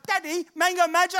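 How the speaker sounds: put-on high, loud little-boy question, rising at the end; imitated young son. Son asks dad after the eighth shop, high pleading child voice. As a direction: play an eager child asking for a treat. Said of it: "my son goes".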